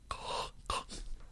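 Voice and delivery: noisy Darth Vader-like breath; imitated snorting breath. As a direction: snort air in like a pig. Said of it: "he also does this thing".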